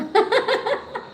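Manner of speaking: a high burst of real laughter. Real, not acted. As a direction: let a sudden high laugh out. (ऊँची हँसी)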